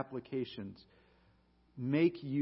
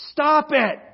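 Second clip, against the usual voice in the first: sharp, loud, higher bark of a command; Bob Newhart's blunt shout; 9 semitones higher, 13 dB louder than usual. Retold or imitated. Counselor cutting off his rambling patient, blunt loud shout. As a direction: snap it like a fed-up counselor cutting her off.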